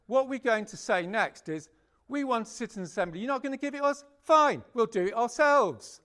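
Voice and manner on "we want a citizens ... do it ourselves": defiant demand. Retold or imitated. To the government, a defiant mock ultimatum. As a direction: throw down the gauntlet to the government.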